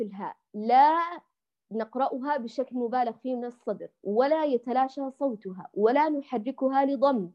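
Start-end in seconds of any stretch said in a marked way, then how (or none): none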